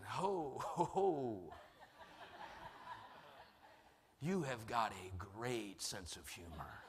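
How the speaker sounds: chuckling ho-ho, then slow and measured; slow mock admiration after a rueful laugh; wry inner reaction. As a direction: wryly concede the joke to God. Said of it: "And I'm thinking".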